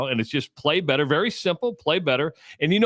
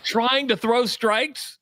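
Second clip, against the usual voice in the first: put-on kid's reply; 8 semitones higher, much slower than usual.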